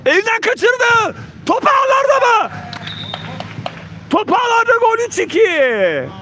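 high shouted goal call; screaming football commentator. Real, not acted. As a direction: scream it as the ball goes in.

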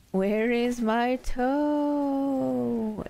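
high, long-held eerie wail; groaning ghostly voice. A narrator's reading. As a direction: moan it out slowly to spook the listener. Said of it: "it groaned".

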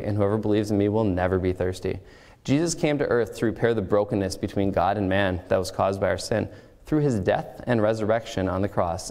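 ordinary talk

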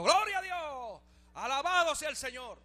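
joyful praise shout. Retold or imitated. Doctor praising the Lord in hospital hallways, joyful loud cry. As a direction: belt it out like a man overjoyed in the hallway.

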